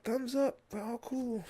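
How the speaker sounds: high, reassuring little character voice; squirrel all-clear. Acted. Squirrel signalling from the pole, high character voice. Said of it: "she's like".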